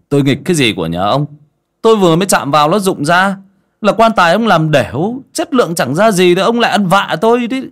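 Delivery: high, loud indignant scolding. A narrator's reading. Young man scolding the coffin maker for blaming him, indignant. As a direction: tell him off hard, rising. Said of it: "nói như mắng".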